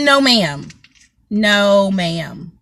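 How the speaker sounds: ordinary talk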